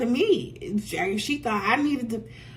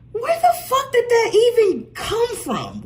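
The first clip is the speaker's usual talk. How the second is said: incredulous; 13 semitones higher, 6 dB louder than usual. Real, not acted. Incredulous about banker's remark.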